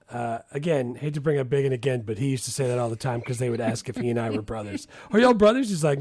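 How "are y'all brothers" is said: higher and louder drawled question; mock question. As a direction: call it out, hammed up. Imitating a friend's question.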